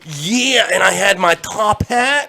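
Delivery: put-on high, loud, slow old-timer voice. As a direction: play a loud old man reminiscing.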